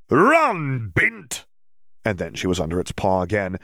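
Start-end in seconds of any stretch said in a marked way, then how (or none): 0.1-1.5 high, loud gleeful taunt; taunting creature. Acted. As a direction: shout it while laughing at prey. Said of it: "the Jarvie laughed".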